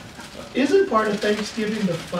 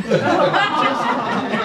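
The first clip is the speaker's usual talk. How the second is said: louder, chuckling echo of the punchline; laughing repeat; 3 semitones higher, 4 dB louder than usual. Real, not acted. Storyteller repeating the wife's punchline, laughing. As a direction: savour the line while laughing.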